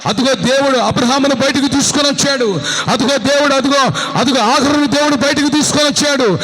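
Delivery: loud, high shouted preaching; full-force preaching. Acted. Preacher rousing the clapping congregation, loud and high. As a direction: shout it out to the crowd.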